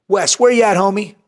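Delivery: hammed-up hailing of a buddy; imitated calling out. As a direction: call across an event, acting both sides. Acting out shout-outs at an event.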